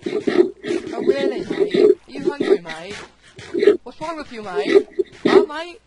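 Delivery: high, loud put-on Australian "mate"; mock Aussie accent. Needling hungry friends, mock Aussie accent. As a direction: needle them in an exaggerated Aussie twang.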